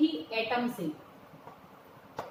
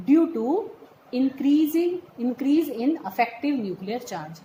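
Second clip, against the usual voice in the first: slow dictation in separated chunks; 3 dB louder, much slower than usual. Real, not acted. Teacher giving students the written reason, slow dictation. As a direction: spell out the note phrase by phrase.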